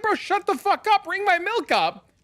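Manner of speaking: very high-pitched mock angry outburst; retold exasperation. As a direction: act out cutting off a chatty cashier. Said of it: "I'm like".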